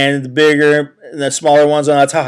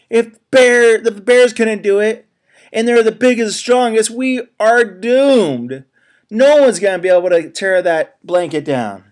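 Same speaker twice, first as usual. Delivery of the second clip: higher, gloomy critter voice; despairing animals; 9 semitones higher, 6 dB louder than usual. Acted. Animals despairing after the bears fail, higher voice. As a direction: moan like doomed little animals.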